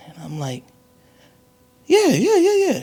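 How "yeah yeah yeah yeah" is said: high, loud, squeaky yeahs; a caught kid bluffing in a high squeal; nervous kid. Retold or imitated. Son answering his mother about the test, high and loud.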